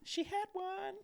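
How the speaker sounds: very high imitated voice; high squeak. Man imitating woman announcing a kitten's birth, high squeak. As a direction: squeak it as an amazed onlooker.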